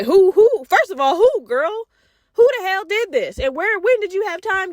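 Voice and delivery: pepper her with thrilled questions; gassed. Retold or imitated. Grilling her best friend about her first time, excited. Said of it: "I'm gassed".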